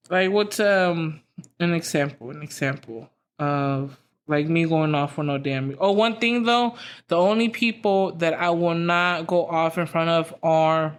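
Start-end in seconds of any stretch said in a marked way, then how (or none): none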